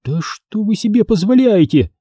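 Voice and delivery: high, affronted; how dare you, in offended tone; shocked indignant retort; indignant outrage. A narrator's reading. Outraged mother snaps back.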